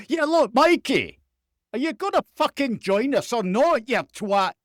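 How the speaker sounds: high, loud, exasperated Scottish reporter; infuriated Scottish accent. Acted. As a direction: lose patience in a hammed-up Scottish accent. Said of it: "infuriated with him".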